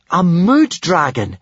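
high, loud, slow exclamation; astonished. A narrator's reading. Hiccup recognises a rare species, astonished. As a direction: exclaim it in amazement. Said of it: "exclaimed in astonishment".

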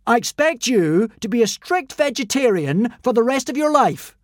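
loud, high commanding voice; godly decree. Acted. Fake god decreeing to the consul. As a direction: command it from above.

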